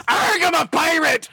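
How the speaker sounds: slow growly mock pirate call; hammed-up pirate cry. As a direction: bark it like a cartoon pirate. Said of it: "I fucking said arg".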